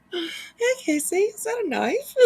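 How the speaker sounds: high, giggly copy of the line; laughing imitation. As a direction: mimic him while cracking up. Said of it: "the tone in his voice was perfect".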